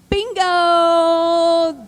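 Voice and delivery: loud, high triumphant cry. Real, not acted. Speaker celebrating the mother's clever letter, loud and high. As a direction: shout it like a winning reveal.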